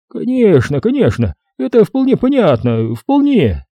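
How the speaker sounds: высокий голос доктора-персонажа; говори за доктора заметно выше своего голоса; доктор, любезно поддакивает. A narrator's reading. Doctor's voice in narrated story. (высокий, успокаивающий голос доктора)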